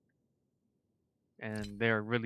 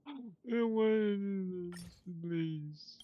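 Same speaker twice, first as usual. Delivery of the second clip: high, drawn-out wailing plea; distraught pleading; 10 semitones higher, slower than usual. Real, not acted. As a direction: beg the game in a high wail. Pleading with the game in dismay.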